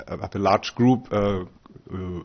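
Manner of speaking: ordinary delivery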